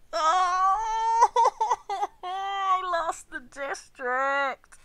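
high, drawn-out laughing lament; despairing laugh stretched into the words; laughing wail. Real, not acted. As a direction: wail it slowly through laughter.

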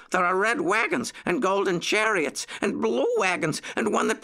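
put-on very high, yappy puppy-boy voice; excited puppy barking. A narrator's reading. Puppy boys telling Uncle Wiggily about circus wagons, excited high barking. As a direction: gush high like an excited little pup. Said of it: "barked Petey Bow Wow".

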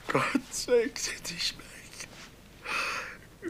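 whispered blessing. Acted. Husband whispering a farewell blessing to his wife. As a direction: breathe the blessing softly.

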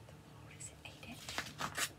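hushed secret whisper. Acted. Secret told to kids.

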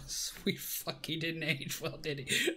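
breathy aside. Real, not acted. Laughing aside at ugly imp.

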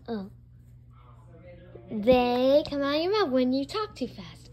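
ordinary talk, nothing marked

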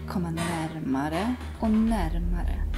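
ordinary delivery

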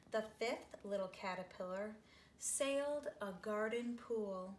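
gentle storybook read-aloud; lilting picture book voice; reading to children. A narrator's reading. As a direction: read it like story time for little kids.

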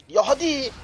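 sharp high exasperated shout; frustrated yell. Real, not acted. Gamer yelling at his teammate, exasperated shout.

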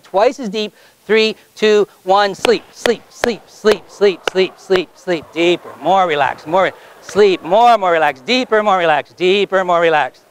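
rhythmic countdown into sleep; hypnotic induction. Acted. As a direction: hypnotist sending volunteers back to sleep.

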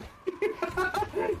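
a burst of high-pitched giggling; high giggle. Real, not acted. Laughing at teammate burning.

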